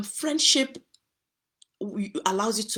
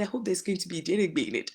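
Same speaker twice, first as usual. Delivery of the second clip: ordinary talk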